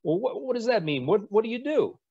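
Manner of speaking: high, mock-incredulous Rickover imitation; mocking admiral. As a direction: admiral teasing a six-striper in a high mocking voice. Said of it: "And Admiral Rickover says".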